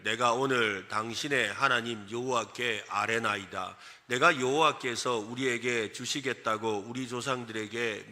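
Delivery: slow, low, measured Bible reading; scripture reading. Acted. Preacher reading the passage to the congregation, solemn scripture reading. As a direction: read it solemnly, on a level low pitch.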